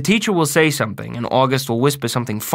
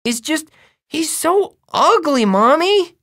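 put-on very high small-boy complaint; little kid pleading with Mommy; little brother; 4 dB louder, slower than usual. A narrator's reading. Little brother voiced in story.

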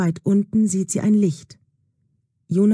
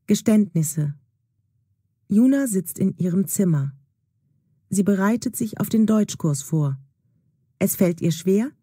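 ordinary delivery

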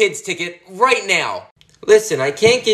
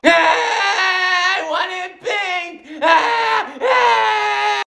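much higher, slow wailing and screaming; crying tantrum; 8 semitones higher, slower than usual. Acted. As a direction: sob and shriek over the wrong slime. Wrong slime colour, crying tantrum.

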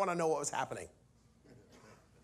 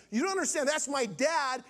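higher-pitched, pressing plea to be understood; urgent insistence; 4 semitones higher than usual. Retold or imitated. Son making the minister understand, urgent and high. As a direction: push "That's my dad" out high and continuous.

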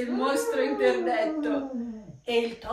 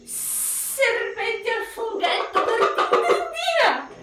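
snake voice; 9 semitones higher, 4 dB louder than usual. Acted. Snake voice in Gruffalo story.

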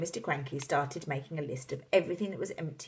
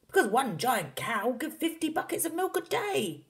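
high, exclaiming father voice; Mister Cranky, excited; 10 semitones higher, 3 dB louder than usual. A narrator's reading. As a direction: proclaim it with wild enthusiasm. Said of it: "cried Mister Cranky".

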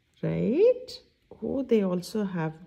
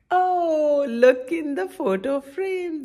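excited discovery; 7 semitones higher, 6 dB louder than usual. Real, not acted. Spots letter for children.

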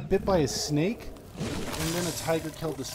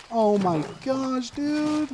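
high, slow, drawn-out wail; distressed exasperation; 10 semitones higher, 4 dB louder, much slower than usual. Real, not acted. Player cornered by the cat, distressed. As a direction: climb higher in dismay.

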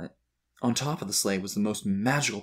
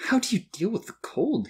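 put-on high-pitched child's question; curious boy; 13 semitones higher than usual. A narrator's reading. Shivering boy asking Santa, high and curious. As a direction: ask high, as a wondering child. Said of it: "he asked".

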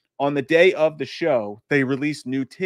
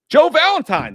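announcing a viewer comment; 10 dB louder than usual. Real, not acted. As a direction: belt the name out like an announcer. Announces a viewer's message loudly.

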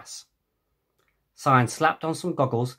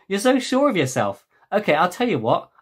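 playful challenge; 6 semitones higher, 4 dB louder than usual. Acted. Challenging friend to a bet.

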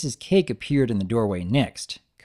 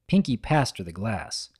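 plain reading voice

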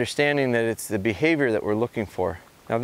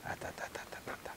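whispered correction; 22 dB quieter, much faster than usual. Real, not acted. Calming dog at close range.

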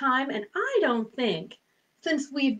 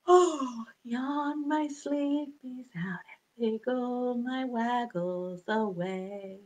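soft drowsy whisper; sleepy whisper; 7 dB quieter, slower than usual. Shown as a demonstration.

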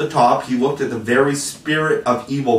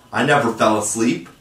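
ordinary delivery, nothing marked

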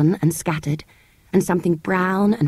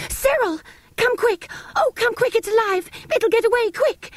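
put-on high, urgent girl's cry for help; excited scream. Girl calls her brother urgently, high excited scream. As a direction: shriek for her brother to come quick. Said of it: "Anthea suddenly screamed".

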